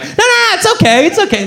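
high-pitched, hurried reassurance; frantic placating. Retold or imitated. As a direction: soothe them in a panicky high voice. Placating caller who wants to leave.

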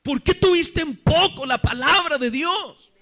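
high, insistent questioning in measured phrases; Dios. Retold or imitated. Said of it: "Dios se hace una pregunta y dice". (reproche divino, agudo e insistente)

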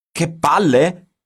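loud, high, slow outraged echo; shouted. A narrator's reading. Giorgio shouting back at the editor's crude slang, loud and high. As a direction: yell it back in disbelief. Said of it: "Urlò Giorgio".

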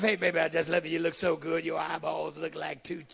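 low, crooning mock pickup line; preacher imitates a man sweet-talking a woman, low and syrupy; smooth talker. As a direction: ham up a cheesy sweet-talker. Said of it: "Not that rapping stuff".

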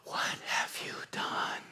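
unvoiced, hissing devil whisper; whispered taunt. The devil taunting the sinner, in a whisper. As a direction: breathe the accusation like a tempter. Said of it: "in that seductive voice".

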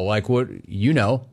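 usual speaking voice

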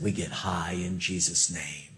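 low, soft, lazy stoner; laid-back stoner voice. Retold or imitated. Retelling the stoner preacher, low and lazy. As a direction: drop it low and easy like a mellow stoner.

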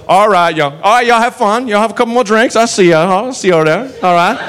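folksy, lilting send-off; easygoing Southern lawman patter; genial Southern cop. Retold or imitated. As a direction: reassure the patrons like a friendly small-town lawman. Genial cop sends patrons off.

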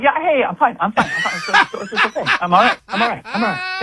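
high, jittery mock reassurance; imitating nervous denial. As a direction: mimic someone nervously insisting they're fine. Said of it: "nervously, going".

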